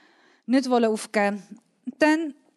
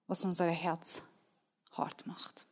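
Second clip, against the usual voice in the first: softer and softer until whispered; fading to a whisper; 4 semitones lower, 10 dB quieter than usual. Acted. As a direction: let the line sink into a whisper. Sermon point fading to a whisper. (leiser werdend bis zum Flüstern)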